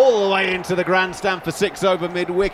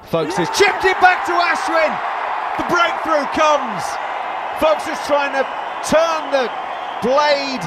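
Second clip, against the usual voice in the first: excited commentary; 9 semitones higher than usual. Real, not acted. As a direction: shout the breakthrough as it happens. Live call of a wicket.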